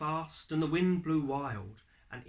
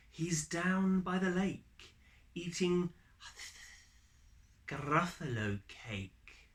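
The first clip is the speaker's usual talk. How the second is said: higher, slow and teasing snake voice; sly snake; 5 semitones higher than usual. Acted. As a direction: draw the words out like a sly snake. Snake answers slyly.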